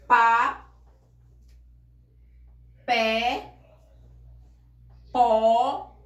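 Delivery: each monosyllable punched out loud; teacher calls out Pá, pé, pó separately, loud and clear; loud model words. Shown as a demonstration. Teacher drilling students on stressed monosyllables, loud. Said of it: "Por exemplo".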